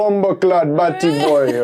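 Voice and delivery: put-on playful Jamaican accent; mock Jamaican accent. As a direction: imitate Jamaican slang with gusto. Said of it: "There's a lot of Jamaicans in London".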